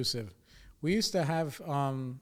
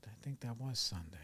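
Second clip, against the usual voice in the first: whispered aside; 10 dB quieter than usual. Real, not acted. Muttering aside to himself.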